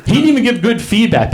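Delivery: high-pitched comic outrage; exasperated disbelief. Acted. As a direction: cry out at how obvious it is. Mock outrage at deluded chef.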